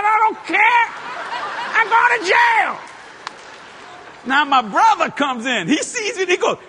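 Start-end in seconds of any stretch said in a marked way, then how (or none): 0.0-2.9 high shouted defiance, slow at the end; defiant shout. Retold or imitated. The man answers the Lord in a high defiant shout. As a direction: yell it back with a laugh.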